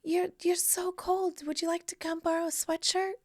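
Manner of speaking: breathy-sounding gentle whisper, high up; soft high Janet voice. Retold or imitated. Janet offers her freezing guest a sweatshirt, softly and high. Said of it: "And she's like".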